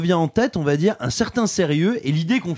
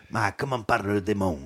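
mock Italian priest; 8 semitones lower, 6 dB quieter than usual. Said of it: "il fait".

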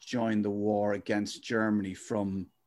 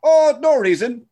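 hammed-up shifty clerk; 12 semitones higher, 8 dB louder than usual.

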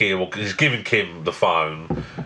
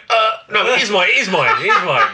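high, louder flustered confession; sheepish admission; 10 semitones higher, 4 dB louder than usual. Retold or imitated. Mate caught out, high flustered admission. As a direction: own up in a flustered rush. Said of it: "And he went".

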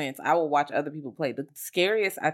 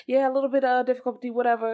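high, fast dismissive voice; 8 semitones higher, faster than usual. Retold or imitated.

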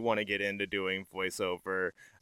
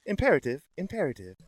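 faster, even computer voice; calm ship computer; 4 semitones higher, faster than usual. Shown as a demonstration. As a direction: read it like a ship's AI. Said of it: "That was pretty good".

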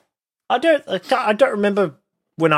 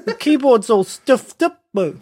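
New Zealand accent; 5 semitones higher than usual. Shown as a demonstration. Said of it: "go with that higher pitch".

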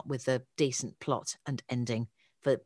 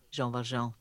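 mock pretentious French accent; 3 semitones lower, 4 dB quieter than usual. Shown as a demonstration. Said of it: "hence the pretentious accent".

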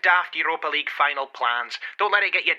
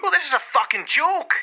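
high-pitched, indignant rant; heated and exasperated; outraged; 12 semitones higher than usual. Acted. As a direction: explode at how ridiculous it is. Indignant outburst over the video.

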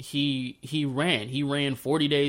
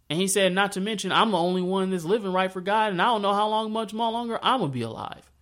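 higher, aggrieved voice of a worn-out prophet; Elijah, complaining; 7 semitones higher than usual. Retold or imitated. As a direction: plead your case up high.

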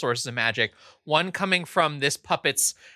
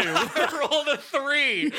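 laughing; 12 semitones higher, slower than usual. Real, not acted. GM laughs at bad roll.

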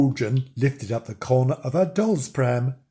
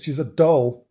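usual speaking voice

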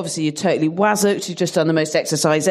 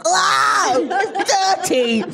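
put-on high, groggy little-kid cry; a sleepy child's yelp of disgust; mimicking toddler; 12 semitones higher, slower than usual. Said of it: "he was like".

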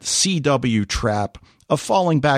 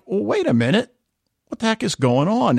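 puzzled double-take; 8 semitones higher than usual. Acted. Acts out viewers' puzzled reaction.

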